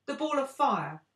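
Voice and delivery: high, loud outcry; crying out. A narrator's reading. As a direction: cry out each question. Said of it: "cried the friends".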